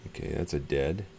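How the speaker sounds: ordinary delivery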